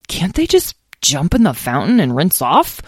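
ordinary delivery

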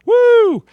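high, loud "woo"; excited whoop. Real, not acted. Radio host cheering Superman's motto, excited whoop. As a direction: let out a ringing, high-pitched whoop.

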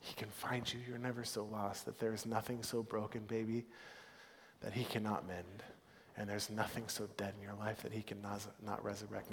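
mother comforting her daughter, hushed and tender; hushed, tender reassurance. Retold or imitated. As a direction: murmur it gently like a prayer over a child. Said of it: "I just kept repeating the words".

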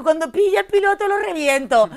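high, loud threat; half-joking retold rage; mock fury. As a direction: blow up at the absent pilot. Furious at the pilot friend.

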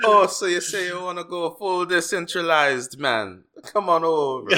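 high hammed-up Jamaican accent. Pitching decentralization to a friend in hammed-up Jamaican accent. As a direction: ham up a Jamaican hustler pitch. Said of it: "apologize to the entire island of Jamaica".

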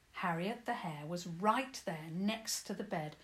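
plain reading voice

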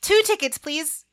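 high, eager order; eager request. A narrator's reading. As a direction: ask brightly and eagerly. Narrator eagerly orders the tickets.

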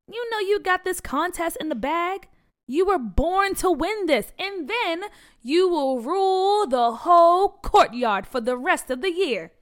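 cheering a nervous friend on, excited. A narrator's reading. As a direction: hype her friend up, building. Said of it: "Dee Dee said to Peach with excitement".

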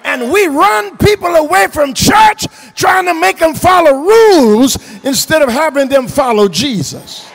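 loud, high, driving pulpit delivery; impassioned preacher shouting to the congregation; full-force preaching. Real, not acted. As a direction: build the list and hammer each phrase home.

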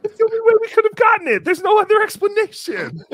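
high, loud, rapid outburst; comic insistence. Acted. Host answers Ralph on how they got sick, comically insistent shout. Comic protest about catching illness.

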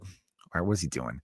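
very low, soft aside; imitated wary puzzlement. Comedian watching a man walk up, hushed puzzlement. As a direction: murmur it low to yourself, puzzled. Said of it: "Chris Rock's even kinda like".

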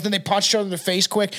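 ordinary delivery

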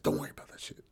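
whispered reassurance; hushed, no voice. Retold or imitated. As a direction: breathe it softly to her. Comforting bearded lady.

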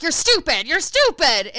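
loud, high-pitched jeer; acted-out insult, shouted high; mock taunting yell. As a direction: hurl it like a playground insult, hammed up. Acted quarrel from the movie.

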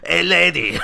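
loud, high comic dog heckle; Triumph the insult comic dog. Retold or imitated. Joining the joke as the insult dog, loud high voice. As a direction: call out in a put-on dog-puppet voice. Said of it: "Sounds like Triumph the insult comic dog".

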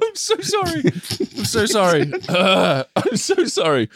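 apologizing through real laughter; can't stop laughing while saying sorry; laughing apology. Real, not acted. Laughing helplessly at friend.

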